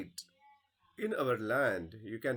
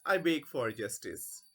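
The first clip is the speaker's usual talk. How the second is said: ordinary delivery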